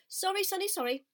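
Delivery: a bustling mother goose. Acted. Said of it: "said the goose".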